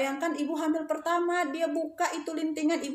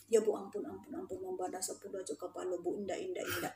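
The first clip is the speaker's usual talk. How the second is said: lower, soft repeated begging; quiet pleading; 5 semitones lower, 11 dB quieter than usual. Retold or imitated. Students begging teacher not to report, quiet pleading. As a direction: imitate students begging under their breath.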